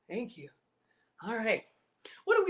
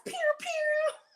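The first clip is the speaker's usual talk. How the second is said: playful pew sound. Pretend. Imitating shots.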